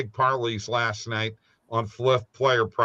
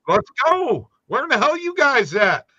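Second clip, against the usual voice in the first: loud and much higher, exasperated; impatient shout; 11 semitones higher, 6 dB louder than usual. Real, not acted. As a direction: yell at them to hurry up. Impatient host calling others out.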